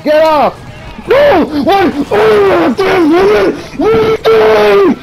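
very high, loud screaming; panicked outrage. Real, not acted. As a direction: shriek in protest, stretching the last words. Screaming at game mishap.

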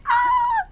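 sudden high scream; startled scream. Real, not acted. Player startled by a monster, high scream. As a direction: scream at the jump scare. Jump scare in game.

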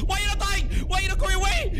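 high, loud frantic shriek; frantic shouting. Real, not acted. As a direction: yell it in desperate frustration. Frustration boils over at enemy.

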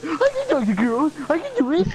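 awkward preteen voice. Retold or imitated. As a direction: insist like a defensive thirteen-year-old. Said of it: "It sounded like a preteen".

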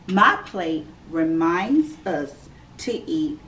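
ordinary talk, nothing marked

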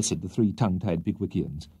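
ordinary delivery, nothing marked

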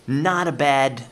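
ordinary delivery

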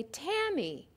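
Tammy with the first syllable Tam stressed. Shown as a demonstration. Teacher showing learners an accented syllable, stress on the Tam of Tammy. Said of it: "the first syllable, Tam, is accented".

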